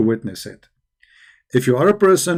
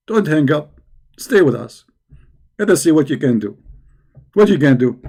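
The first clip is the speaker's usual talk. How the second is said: usual speaking voice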